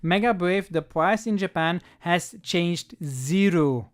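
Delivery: ordinary talk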